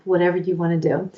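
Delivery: ordinary talk, nothing marked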